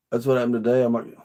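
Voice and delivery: ordinary talk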